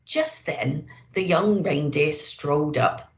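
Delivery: ordinary delivery, nothing marked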